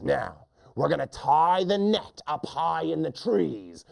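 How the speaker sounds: mean hunter. Acted. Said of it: "the lion hunter says".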